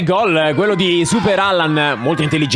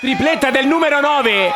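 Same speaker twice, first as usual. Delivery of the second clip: excited announcement; 8 semitones higher than usual. Real, not acted. Announcing hat-trick.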